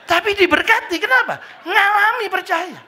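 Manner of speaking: high, loud proclamation; preaching climax. Real, not acted. Pastor proclaiming to the congregation, high and loud. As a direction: drive the point home.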